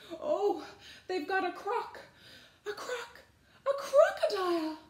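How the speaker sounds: very high panicked voice, halting between attempts; terrified stammer. A narrator's reading. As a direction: stammer it out in comic terror. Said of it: "He stammered".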